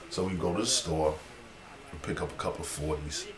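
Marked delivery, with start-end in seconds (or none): none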